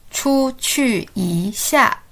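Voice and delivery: very slow, syllable by syllable; slow model for repeating. Shown as a demonstration. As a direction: draw out each syllable for learners to echo. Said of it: "Let's say together".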